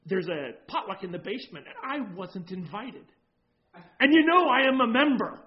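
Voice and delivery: huffy complaint building to a loud burst; indignant church lady. Retold or imitated. Church lady scolding the new pastor, offended and rising. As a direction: voice rising to an offended shout at the end.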